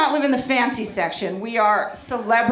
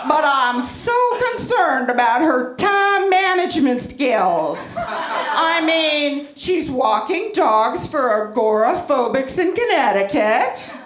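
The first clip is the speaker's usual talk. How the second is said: humble-bragging mother; 3 semitones higher than usual. Retold or imitated. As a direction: gush like a smug Facebook mom pretending to worry. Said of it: "something like this".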